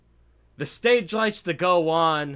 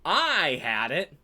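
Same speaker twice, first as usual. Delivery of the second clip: smug boast; 8 semitones higher, 4 dB louder than usual. Retold or imitated. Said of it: "he's like".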